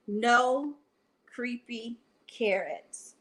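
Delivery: ordinary delivery, nothing marked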